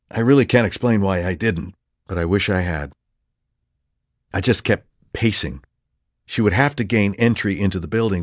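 plain reading voice

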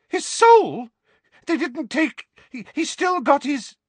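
very high, panicked, breaking off; shriek, then stammer in horror; horrified scream. A narrator's reading. Mother fearing for her son's soul, horrified. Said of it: "Aunt Petunia uttered a soft scream".

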